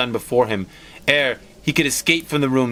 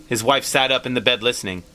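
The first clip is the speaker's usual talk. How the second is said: ordinary delivery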